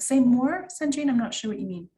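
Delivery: usual speaking voice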